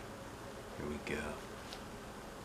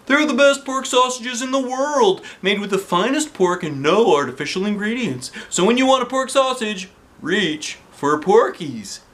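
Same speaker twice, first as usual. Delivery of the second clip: cheesy ad voice; 8 semitones higher than usual. Acted. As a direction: sell it like a TV commercial. Principal reads sausage ad.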